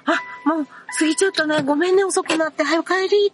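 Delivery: high, gentle imitation of a kind employee; kind staff member. Kind employee telling the part-timer to go home, high and gentle. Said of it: "すごい優しい社員さん".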